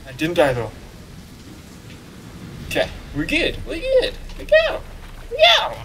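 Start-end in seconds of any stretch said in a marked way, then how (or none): 3.7-5.8 very high squeaky chirps; silly falsetto cheer; playful squeak. Pretend. Player freed from the tree and going, playful squeak.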